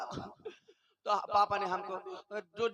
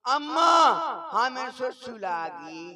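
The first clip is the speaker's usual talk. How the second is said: put-on whiny child; 4 semitones higher, 7 dB louder, slower than usual. Child tells mother he needs to pee.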